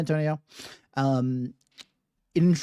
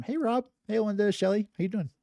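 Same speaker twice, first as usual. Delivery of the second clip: higher-pitched hello to people joining; pitched-up quick hello; friendly greeting; 7 semitones higher, faster than usual. Real, not acted. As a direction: greet newcomers in a lifted voice. Greeting viewers as they join.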